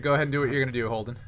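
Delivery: normal talking voice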